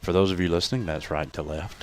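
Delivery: deep, low, soft announcer voice; radio voice. Pretend. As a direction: drop into a smooth radio announcer voice. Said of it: "You like the way I did my radio voice?".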